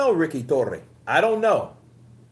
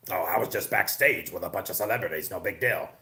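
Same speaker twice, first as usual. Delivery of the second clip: mock dismissive Fury; 6 semitones lower, 3 dB quieter than usual. Mocking Fury's evasive answer.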